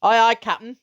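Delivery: a father teasing with a mock salute. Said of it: "gave her a mock salute".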